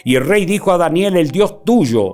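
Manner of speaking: loud, declaiming Bible reading; preaching scripture. Acted. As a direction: proclaim the verses to the congregation. Preacher reads scripture aloud.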